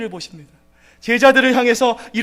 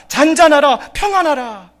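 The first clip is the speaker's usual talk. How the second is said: higher, louder commanding rebuke; rebuke the storm; 5 semitones higher, 8 dB louder than usual. Retold or imitated. Jesus rebuking the raging waves, commanding and loud. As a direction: command the sea to be still. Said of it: "성난 물결을 꾸짖었습니다".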